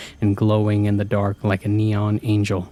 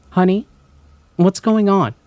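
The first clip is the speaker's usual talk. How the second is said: high, raised questioning mom; familiar mother's voice; 10 semitones higher, 5 dB louder than usual. A narrator's reading. Mom at the locked door asks, familiar voice. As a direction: coax from behind a door, pitched high. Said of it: "A familiar voice came through the door".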